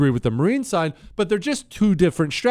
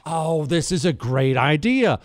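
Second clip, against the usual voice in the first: normal talking voice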